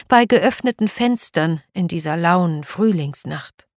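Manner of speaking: plain reading voice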